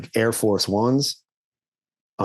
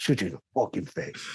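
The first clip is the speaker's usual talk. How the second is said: mock Alec Baldwin; 3 semitones lower, 8 dB quieter, faster than usual. Continuing Baldwin impression.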